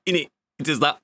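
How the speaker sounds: imitating a London roadman accent; quick London roadman impression. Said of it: "He talks like a roadman".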